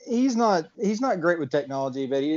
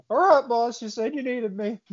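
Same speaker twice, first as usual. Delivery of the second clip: put-on eager teen voice; 8 semitones higher than usual. Voicing teen character Ethan.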